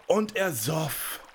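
slow, high, drawn-out climax; swelling emphasis. A narrator's reading. Narrator shows the giant about to burst, slow and emphatic. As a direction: stretch every word as the drinking peaks.

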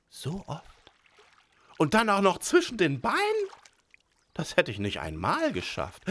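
high, stunned imp voice; amazed little devil. A narrator's reading. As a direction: marvel like a beaten imp. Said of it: "Der Mund stand ihm offen".

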